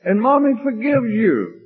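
high, hammed-up motherly cooing; exaggerated comforting. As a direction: soothe a child in a very high voice. Second take, high exaggerated comforting.

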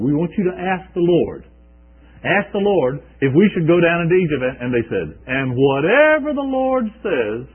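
loud pleading. Acted. People pleading with Jeremiah.

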